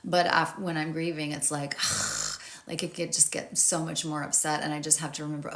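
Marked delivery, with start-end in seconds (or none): 1.8-2.6 long, low, voiceless exhale; acted groaning sigh of frustration; exasperated sigh. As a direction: let out a fed-up breath. Acts out grief-fuelled exasperation.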